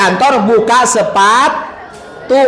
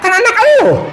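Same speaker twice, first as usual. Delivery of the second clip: higher mocking wife; wife retorting; 9 semitones higher than usual. The wife reminds the husband it is his own child, higher and scornful. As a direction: snap back as a scornful wife.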